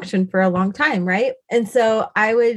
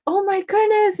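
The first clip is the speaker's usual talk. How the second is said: high-pitched retold gasp of wonder; amazed exclamation; 12 semitones higher than usual. Mom reacts to her daughter hearing from God, amazed. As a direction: exclaim it much higher, at normal volume.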